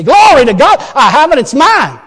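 loud, high triumphant declaration; triumphant claim. Pretend. Believer insisting on healing despite looks, triumphant shout. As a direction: claim it like it's already yours.

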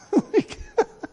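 high burst of laughter. Real, not acted. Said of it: "high laugh".

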